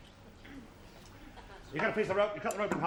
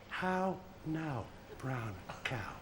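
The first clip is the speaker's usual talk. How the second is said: soft repeat; 4 semitones lower, 8 dB quieter, much slower than usual. Retold or imitated. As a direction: echo the whisper quietly, much softer and lower. Patient repeats the doctor's words.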